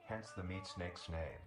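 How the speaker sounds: ordinary delivery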